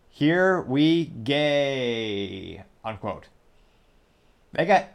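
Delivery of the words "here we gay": slow read-out with a long, sliding final word; drawn-out quote. Retold or imitated. Reading a rooster's comment to viewers, drawn out. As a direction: stretch each word, holding the last one very long. Reads a comment aloud for laughs.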